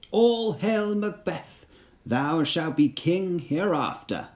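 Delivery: high, slow, otherworldly witch voice, each word drawn out; an uncanny crone hailing a general from the mist; eerie witch cry. Acted. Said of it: "the third witch's greeting".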